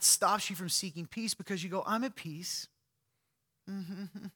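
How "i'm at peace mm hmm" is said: a little higher, soft smug woman; feminine voice. Retold or imitated. Preacher mocking a passive aggressive woman, soft higher voice. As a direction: sweetly claim to be at peace, mock. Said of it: "I did a feminine voice".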